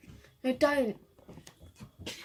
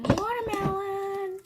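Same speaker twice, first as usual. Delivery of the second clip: excited exclaim; 10 semitones higher, much slower than usual. Real, not acted. Exclaims the slime name.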